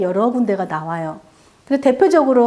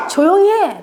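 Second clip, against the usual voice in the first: retold sharp rebuke, higher pitched; scolding; 7 semitones higher, 4 dB louder than usual. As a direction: hush someone as an imitated angry crowd. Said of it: "라고 꾸짖습니다".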